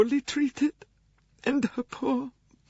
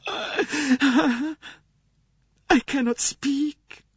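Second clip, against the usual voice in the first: much higher, tearful sobbing voice; weeping; 3 dB louder than usual. Acted. Swedish nurse confessing to Poirot, weeping. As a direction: cry the words out in grief. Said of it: "wept the wretched woman".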